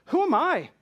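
loud, high cry of self-questioning; passionate self-rebuke. Acted. Preacher turning the challenge on himself, loud questioning cry. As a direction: call it out against your own pride. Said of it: "That's why I'm talking passionately".

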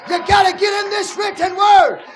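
rising, shouted sermon climax; full-force preaching. Acted. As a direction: hammer it home to the congregation.

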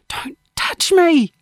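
high, drawn-out outburst; angry recoil. A narrator's reading. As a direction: push them away with the words. Lacey recoils from friend's hand.

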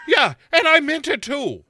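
high, loud mock Irish retort; imitating Sarah's accent. Sarah answering the killer back, high mock-Irish shout. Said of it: "And she's like".

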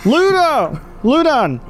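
high-pitched acted holler; calling out. Voice in the house answering travelers, calling out.

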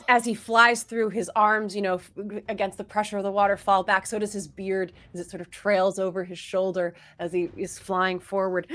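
ordinary delivery

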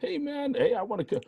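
mock high swaggering voice, well above his own; cocky old champion. Host voicing a retired pole vaulter, high mock brag. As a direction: ham up a brash old athlete boasting.

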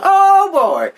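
high, loud thrilled exclamation; excited. Retold or imitated. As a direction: burst out with boyish glee. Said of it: "I'm like".

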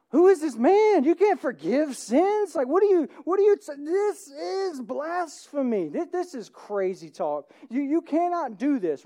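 loud, high, scandalized protest; outraged Pharisees. Acted. Pharisees protest Jesus forgiving sins, loud outrage. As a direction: cry out at the blasphemy.

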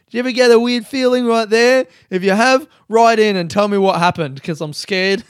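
playfully spooked, asking listeners to write in; mock worry. Vlogger to listeners, mock-alarmed and loud.

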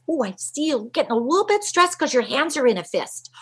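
mild put-on observing parent; lightly voiced parent. Parent pointing out a kid's stress sign, lightly voiced.

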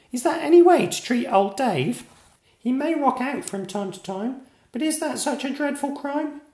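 a small knight telling off the town; indignant piping kid lecture; furious little knight. A narrator's reading. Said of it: "a furious knight with eyes aflame".

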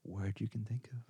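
playful campfire-scare delivery; mock spooky. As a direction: drag it out, then drop to a hush. Spooky question, imitating co-host.

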